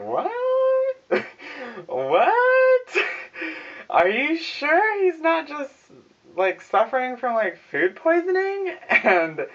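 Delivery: hammed-up family gasping at the news; shocked family. Family told he likes his cooking, hammed-up shock.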